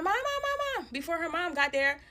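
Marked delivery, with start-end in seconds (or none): 0.0-0.9 put-on high-pitched child's cry for mom; imitated screaming. Little girl calling for her mom, mock screaming. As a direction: mimic a kid yelling for mom, high. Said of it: "she started screaming for her mom".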